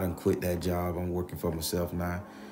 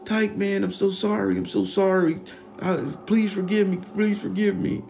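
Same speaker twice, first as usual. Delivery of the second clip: high, louder begging apology; begging Ralph; 13 semitones higher, 6 dB louder than usual. Retold or imitated. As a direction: beg Tyke to forgive you. Ralph begs forgiveness.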